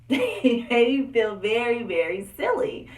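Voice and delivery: laughing retelling. Real, not acted. Telling viewers how dad embarrassed her, laughing.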